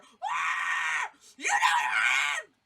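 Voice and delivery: loud, drawn-out mock scream; exasperated full-throated yell; comic scream. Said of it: "I just scream into the darkness".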